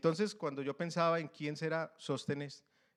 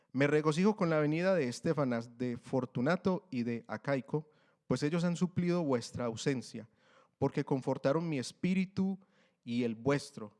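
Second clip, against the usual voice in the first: louder, solemn reading of the Bible; scripture reading; 6 dB louder than usual. Acted. Preacher reads the greeting to the church, louder and solemn. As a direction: proclaim the verses to the congregation.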